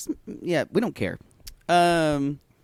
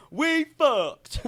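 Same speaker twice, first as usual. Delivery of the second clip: higher blunt mock line; 11 semitones higher than usual. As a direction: say it bluntly, mocking. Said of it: "I need him to say".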